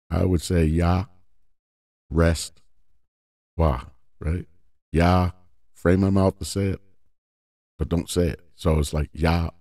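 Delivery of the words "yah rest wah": Yah, rest, Wah with a held silent beat; slow Yah, pause, rest, pause, Wah; three syllables with a silent middle. Shown as a demonstration. Teacher spelling the name out slowly. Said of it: "frame your mouth as if you're gonna say it".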